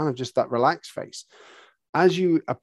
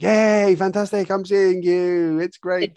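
higher, louder fake cheer; mock enthusiasm; 7 semitones higher, 5 dB louder, slower than usual. A horse pretending to be pleased at visitors, mock cheer. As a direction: overdo the happy welcome.

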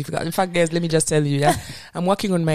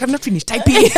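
flustered protest; 8 semitones higher, 6 dB louder than usual. Retold or imitated. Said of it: "I was just like".

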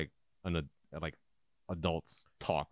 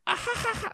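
very high-pitched mock giggle; mimicked kids' laughter; faster than usual. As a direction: titter like kids at a swear word. Imitating kids laughing at the word.

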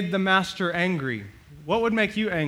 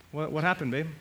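ordinary talk

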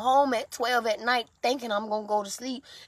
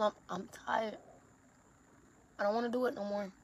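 despairing; 8 dB quieter, faster than usual. Real, not acted. Despairing, giving up.

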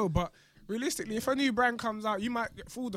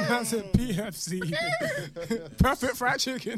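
laughing; 4 semitones higher, slower than usual. Real, not acted. Joking PFC name, laughing.

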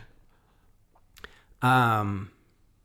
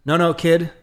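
eager interruption; 6 semitones higher, 8 dB louder than usual. Retold or imitated. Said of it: "he cuts me off and says".

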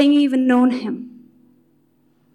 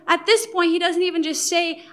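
normal talking voice

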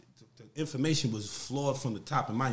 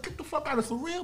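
mock disbelief; 12 semitones higher than usual. Disbelief at helicopter story.

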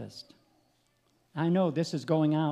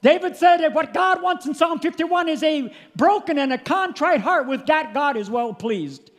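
high, loud revival preaching; preaching at full force; 11 semitones higher, 9 dB louder than usual. Acted. Preacher to congregation about Jesus praying, preaching at full force. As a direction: preach it out to the church, full throat.

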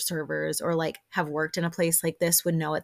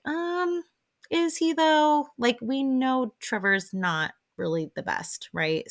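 skeptical retort; 6 semitones higher than usual. Retold or imitated. Narrator voicing her own skeptical retort.

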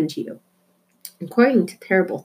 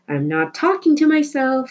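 shouted protest; 7 semitones higher than usual. A narrator's reading. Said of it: "Flora shouted".

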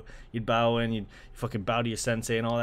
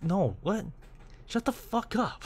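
exasperated shut up; 7 semitones higher, 4 dB quieter, slower than usual. Real, not acted. Mocking absurd translation.

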